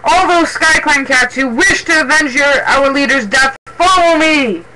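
high, loud call to follow; impassioned leader's shout; yelled rallying cry. A narrator's reading. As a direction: summon warriors to vengeance. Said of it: "he yelled".

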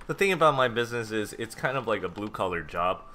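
ordinary delivery

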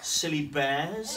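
slow, drawn-out rhyme reading; reading to children. A narrator's reading. Teacher reading rhymes to young pupils, slow and playful. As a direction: linger on each silly line. Reading rhyming picture book aloud.

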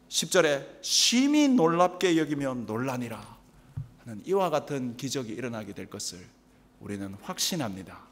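low, soft prayerful benediction; solemn blessing. Acted. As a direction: close the sermon with a quiet blessing. Closing benediction.

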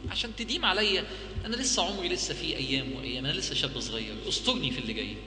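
quiet, pleading prayer; soft humble prayer. Retold or imitated. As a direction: pray softly to God for cover. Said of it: "وقول له". (دعاء هادئ متضرع بصوت منخفض)

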